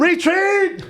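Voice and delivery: high-pitched call to retreat; mock battle cry. Joking Spartan formation against the wall, mock battle cry. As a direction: cry out like a panicked soldier.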